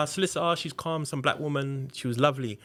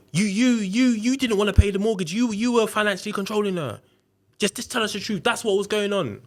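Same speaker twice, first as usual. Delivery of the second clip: raised, higher-pitched accusing voice imitating a detective; angry interrogator; 8 semitones higher, 4 dB louder than usual. Detective banging the table at a suspect, imitated angry interrogator. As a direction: hammer out the accusations in mock anger. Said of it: "why are you so angry".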